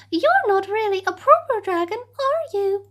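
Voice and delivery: high, snooty and fast; sniffy princess. Acted. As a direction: look down your nose at him. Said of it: "she sniffed".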